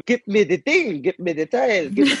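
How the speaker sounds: put-on high, demanding little kid voice; child mixing articles. Kid in kindergarten demanding things, high and bossy. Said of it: "da war ein Kind".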